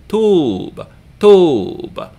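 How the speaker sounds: tub with the vowel held long, like "toob"; high, drawn-out oo in "tub". Shown as a demonstration. Teacher showing the same syllable only long, held vowel in tub. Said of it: "only long".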